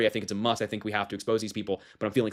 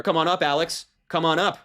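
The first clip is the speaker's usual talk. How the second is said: mock game-show host; 6 semitones higher than usual. Mock calling guest to stage.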